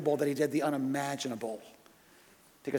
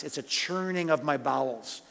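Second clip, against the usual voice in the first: ordinary talk, nothing marked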